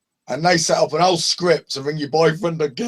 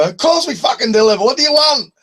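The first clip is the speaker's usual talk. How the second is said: high, loud mock Scottish rant; angry Scotsman; 8 semitones higher, 4 dB louder than usual. Angry Scottish pizza man yelling at a prank caller. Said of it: "the angry Scotsman".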